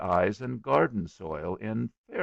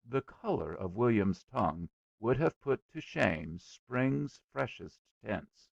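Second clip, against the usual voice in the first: ordinary delivery, nothing marked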